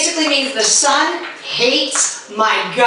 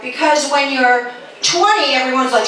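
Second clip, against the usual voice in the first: ordinary talk, nothing marked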